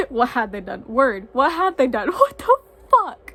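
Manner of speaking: loud, choked-up disbelief; teary outcry. Real, not acted. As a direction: push the words through tears. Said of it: "I don't know why I'm crying".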